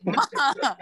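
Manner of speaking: giggling through the word; can't keep a straight face; laughing ma. Real, not acted.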